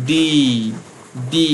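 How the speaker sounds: "ডি" drawn out on its "ee" vowel, each one separate; ই-ধ্বনি স্পষ্ট. Shown as a demonstration. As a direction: sound out "ডি" slowly so the ই inside it is heard. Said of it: "তাহলে তার মাঝে একটা ই আসবে". ("ডি"-র ভেতরের ই টেনে স্পষ্ট উচ্চারণ)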